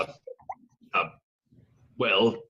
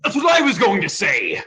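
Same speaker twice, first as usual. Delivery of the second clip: indignant shout; 7 semitones higher, 6 dB louder than usual. Acted. Pirate annoyed at being interrupted.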